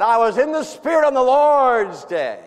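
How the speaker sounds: soaring high, loud scripture quote; exalted quote. Retold or imitated. Pastor proclaiming the verse to his congregation, soaring and loud. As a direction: lift the verse up in rapture. Pastor reliving John's worship.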